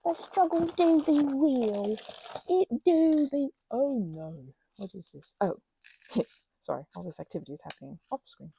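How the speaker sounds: ordinary talk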